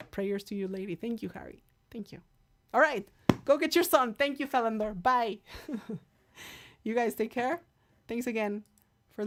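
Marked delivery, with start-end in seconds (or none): none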